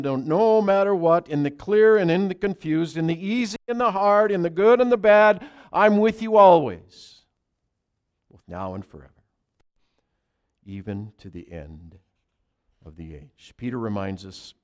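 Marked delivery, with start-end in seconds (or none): 0.0-7.3 loud, high, building sermon promise; preaching at full force. Acted. Pastor proclaiming Jesus' promise to congregation, full force. 8.3-13.5 much softer, gentle falling finish; hushed tender. Acted. Jesus promising disciples his presence, hushed and tender.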